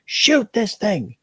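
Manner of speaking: slow, high, drawn-out order; retold order in a strained high voice; urgent command. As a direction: act out an insistent command, stretching each word. Kid orders him to shoot.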